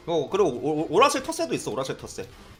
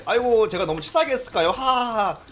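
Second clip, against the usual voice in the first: mock humble, with a fake ha-ha-ha; smug fake modesty; 7 semitones higher, 3 dB louder than usual. Winner taunting a beaten rival with a smug fake laugh. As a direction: gloat politely after winning, laughing it off. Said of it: "눈웃음".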